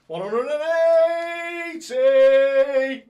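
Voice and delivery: very slow, very loud, high sung-out call; his signature maximum call; his own darts caller call. Acted. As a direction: hold every word, roaring like a stage MC. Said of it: "I could just do it my way".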